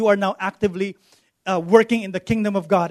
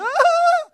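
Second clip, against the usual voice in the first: mock scream; 4 dB louder than usual. Scared person running in mall.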